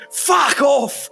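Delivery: put-on high, loud drunken shout; drunk yell. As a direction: snap it at the clock like a drunk. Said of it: "and just goes".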